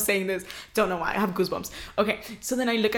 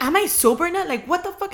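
retold screaming; 8 semitones higher than usual. Imitating her friend screaming about being sober, high mock yell. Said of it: "She just kept screaming".

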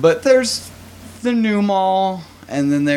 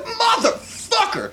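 much higher, slow, laughing mock outburst; exploding curse; slower than usual. Retelling how he lost it at the sock-pushing clerk, high and laughing. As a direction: let it burst out of you, half laughing.

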